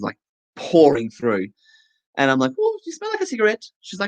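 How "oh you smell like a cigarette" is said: high quick mock whine; mock complaint. Client complaining about cigarette smell, high mock whine. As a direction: squeak it out, quick and fussy.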